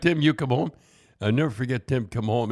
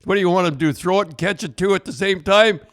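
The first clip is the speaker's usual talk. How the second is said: imitated outraged wife, pitched up and loud; indignant retort; 7 semitones higher, 5 dB louder than usual. Wife defending husband over dropped balls, imitated indignant outburst. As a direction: raise the voice in mock exasperation, climbing to the end. Quoting the quarterback's angry wife.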